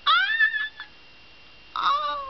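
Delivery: very high, drawn-out "ah" of delight; real squeal of wonder. Real, not acted. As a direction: let out a long high squeal. Delight at the last gift.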